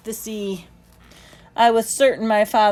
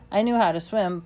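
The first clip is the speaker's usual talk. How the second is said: usual speaking voice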